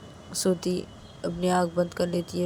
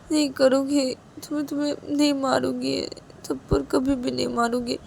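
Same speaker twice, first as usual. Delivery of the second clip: crying plea; 7 semitones higher than usual. A narrator's reading. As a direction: beg for forgiveness while sobbing. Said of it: "रोने का आवाज़ सुनती है". (रोते हुए माफ़ी माँगना)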